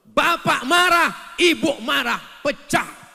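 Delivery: high, loud, slow; loud pulpit emphasis; loud slow proclamation. Acted. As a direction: boom it out. Preacher describing quarrel.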